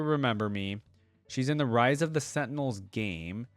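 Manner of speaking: ordinary talk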